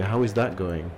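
usual speaking voice

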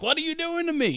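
high-pitched protest of a put-upon dog; indignant dog. Acted. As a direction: give the hosed dog a high, wounded voice. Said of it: "the dog's like".

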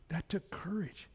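soft, voiceless admiration; awed whisper. Retold or imitated. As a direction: breathe it in a hushed whisper. Pastor's hushed reply to confession.

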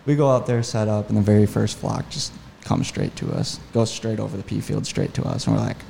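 ordinary talk, nothing marked

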